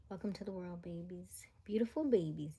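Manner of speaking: soft breathy whisper. Real, not acted. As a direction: whisper tenderly to the newborns. Whispered greeting to babies.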